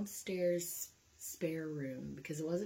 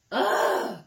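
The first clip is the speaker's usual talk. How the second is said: frustrated ugh; 12 dB louder than usual. Retold or imitated. Frustrated groan ending the quote.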